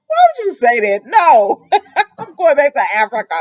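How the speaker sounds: loud, high mock protest breaking into laughs; laughing protest. [name] tells Nicole he's leaving, loud protest through laughter. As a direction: refuse loudly while losing it laughing.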